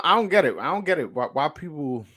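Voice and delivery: usual speaking voice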